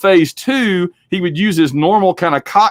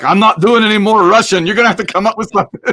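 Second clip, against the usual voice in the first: imitating a fed-up star, higher pitched; mock refusal; 6 semitones higher, 3 dB louder, faster than usual. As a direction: play an actor putting his foot down, rushing the ending. Acts out Connery refusing on set.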